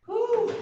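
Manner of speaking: loud high exhale of relief; relieved whew. Real, not acted. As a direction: blow it out after effort. Exhale after a hard set.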